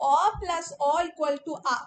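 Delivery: "অ plus অ equal to আ" said slowly and firmly, stressing "অ"; slow rule drill. Shown as a demonstration. Teacher drills the sandhi rule for memorising, slow and firm. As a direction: dictate the rule so they can memorise it. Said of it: "মনে থাকবে?".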